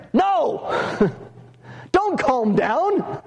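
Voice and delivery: high-pitched, drawn-out protest; emphatic refusal. Acted. Preacher relaying Paul's answer to calm down, emphatic high refusal. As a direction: pitch jumps up in rousing objection.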